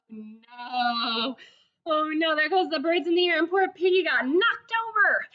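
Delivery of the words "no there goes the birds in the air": ordinary delivery, nothing marked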